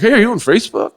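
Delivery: high, loud, dorky fan voice; eager dork. Retold or imitated. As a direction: blurt it like an awkward fan. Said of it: "I was such a dork".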